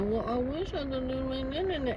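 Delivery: high, soft mock whine; whining little boy. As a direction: imitate a pitiful little boy whining wordlessly. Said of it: "I wish I could imitate him".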